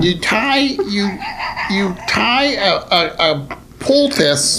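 high, slow comic character; put-on character voice. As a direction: pitch it up and dispense a folk remedy slowly. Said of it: "what voice was that".

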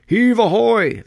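loud, high hauling call; sailors' shout. A narrator's reading. Sailors hauling chests from the hold, shouting. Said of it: "they shouted as each chest came up".

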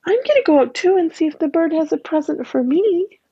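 put-on a girl's eager voice; little girl Anne Marie. A narrator's reading. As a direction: chirp it like a hopeful little girl. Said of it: "Anne Marie started to speak".

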